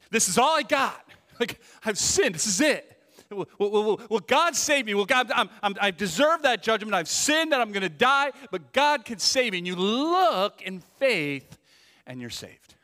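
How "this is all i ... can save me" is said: higher, louder pleading to God; desperate plea. Retold or imitated. Sinner crying out to God for rescue, desperate plea. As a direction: beg for rescue, stammering.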